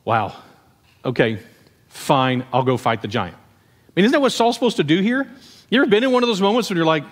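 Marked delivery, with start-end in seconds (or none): 0.0-3.3 low, resigned mock acceptance; mildly reluctant. A man imagining himself shamed into fighting, mildly reluctant. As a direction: grudgingly give in, pitched low.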